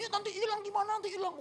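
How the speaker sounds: very high-pitched fretting voice; fretful older woman passenger. Retold or imitated. First-time flyer clutching her bag before stewardess, fretful. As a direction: whine in a very high mock voice. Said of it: "ibu-ibu ini pertama kali juga naik pesawat".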